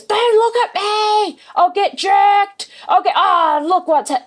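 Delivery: very high, slow, frantic dog voice; panicky monster dog. Acted. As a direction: squeal it like a scared dog begging not to be seen. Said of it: "the monster goes".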